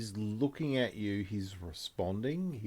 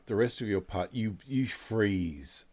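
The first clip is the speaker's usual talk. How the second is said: ordinary talk, nothing marked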